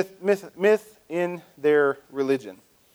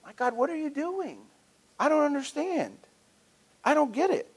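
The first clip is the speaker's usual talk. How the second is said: bewildered plea; 11 semitones higher than usual. Retold or imitated. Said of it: "That's my paraphrase".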